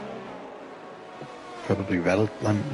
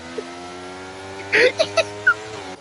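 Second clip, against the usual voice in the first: laughing; 2 semitones lower than usual. Real, not acted. Laughs at crash.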